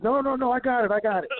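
high, insistent imitation of a colleague; imitated hasty refusal. Wrestler turning down help with the blade, high-pitched insistent refusal.

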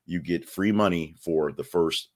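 usual speaking voice

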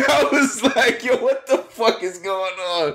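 high, laughing disbelief. Real, not acted. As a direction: laugh through the words. Laughing retelling his reaction.

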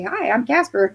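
a hint of a ghost saying hello; Casper. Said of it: "have Casper pop right out".

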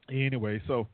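ordinary talk, nothing marked

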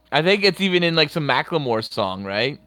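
usual speaking voice

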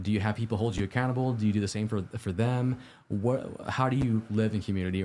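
ordinary talk

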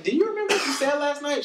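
high, pointed teasing question; mock teasing question. Teasing his girlfriend about her drunken rant, high mock question.

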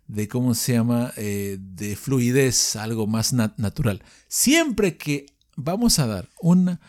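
ordinary delivery

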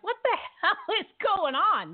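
half-laughing what-the-hell disbelief; mock outrage at the news, laughing; incredulous outburst. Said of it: "I'm like".

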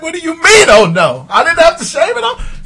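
high, loud, laughing mock outrage; laughing protest. Retelling protest to his wife, laughing. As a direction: plead through laughter. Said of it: "I was like".

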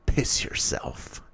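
very slow breathy whisper; whispered command. A narrator's reading. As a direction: whisper it slowly like a threat. Damien commands bully with power.

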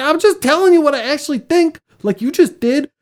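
high-pitched retold self-defense; exasperated protest. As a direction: protest in mock exasperation, voice jumping up. Retold argument with direct-spoken Germans.

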